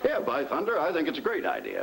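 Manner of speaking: high-pitched, gleeful exclamation; tickled and enthusiastic; sudden delight. Acted. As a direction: burst out in delighted agreement, voice shooting up.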